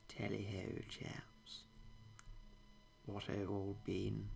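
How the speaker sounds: a bolder take on the jolly English toff; hammed-up upper-class Brit greeting; mock posh British. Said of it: "nice caricature of British culture".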